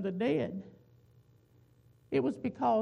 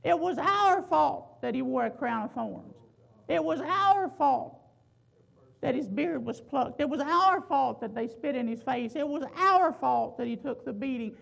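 louder, insistent refrain, "our" hit every time; building preaching; 3 semitones higher, 5 dB louder than usual. Acted. Preacher drives home the congregation's guilt in a rising refrain. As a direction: preach each line harder, pounding "our".

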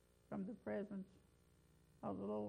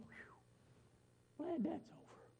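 breathy, whispered sigh of relief; relieved Jonah; 5 semitones higher, 7 dB quieter, much slower than usual. Retold or imitated. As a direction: let out the relief after the ordeal. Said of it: "and you go".

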